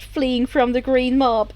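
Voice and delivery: ordinary delivery, nothing marked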